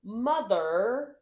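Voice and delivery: high, loud, swooping proclamation; hammed-up Brittany Broski. Podcast guest baffling the host with slang, theatrical. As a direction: punch and stretch each word theatrically. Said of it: "why you're talking like that".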